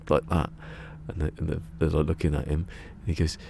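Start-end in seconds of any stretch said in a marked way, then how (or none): none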